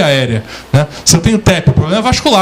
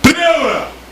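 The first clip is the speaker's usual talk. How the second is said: high call shouted over the void; shouted into the canyon; 9 semitones higher than usual. Shown as a demonstration. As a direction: yell it out over the canyon edge. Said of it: "vou gritar". (grito alto na beira do Grand Canyon)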